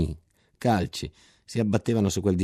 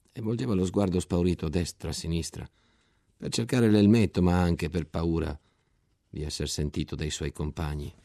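plain reading voice

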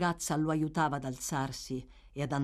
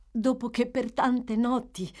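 higher, tender woman's voice; feminine tenderness; 6 semitones higher than usual. A narrator's reading. Girl pleads with the man she nursed, tenderly. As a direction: soften into a tender plea. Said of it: "con una nota di tenerezza femminile".

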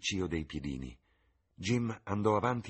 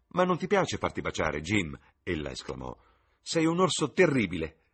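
voce di ragazza, più acuta, piena di slancio; giovane innamorata; 3 semitones higher, 6 dB louder than usual. Acted. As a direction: fanciulla entusiasta che si confida. Sibyl scherza col fratello. (Sibyl alla madre, voce acuta e sognante)

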